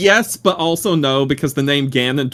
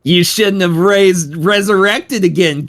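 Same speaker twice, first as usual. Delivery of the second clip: hammy villain taunt; 7 semitones higher, 5 dB louder than usual. Pretend.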